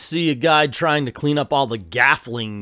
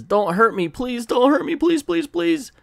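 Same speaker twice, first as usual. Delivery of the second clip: high-pitched panicked pleading, rising higher with each please; frantic begging; 13 semitones higher than usual. Retold or imitated. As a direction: beg for your life in a squeaky terrified voice. Captive begs for his life.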